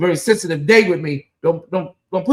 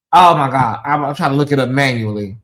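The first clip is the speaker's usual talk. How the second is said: usual speaking voice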